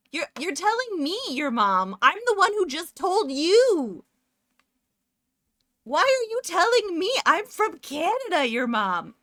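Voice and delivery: high, loud playful indignation; mock outrage. Streamer to viewer explaining her own slang back, mock outrage. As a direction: protest loudly, as if deeply affronted.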